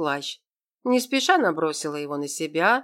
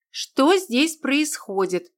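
higher, slow, loud demand; loud question; 7 semitones higher, much slower than usual. A narrator's reading. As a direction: announce yourself from the doorway. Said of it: "громко спросила".

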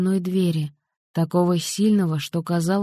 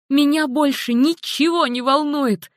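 high, defiant outburst; cry out; 8 semitones higher than usual. A narrator's reading. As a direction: fling the words at them. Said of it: "выкрикнула Хелен".

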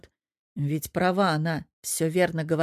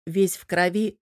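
usual speaking voice

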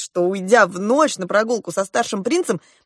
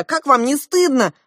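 high-pitched reproach; raised scolding cry; 6 semitones higher, 3 dB louder than usual. A narrator's reading. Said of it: "крикнула я". (возмущённый окрик)

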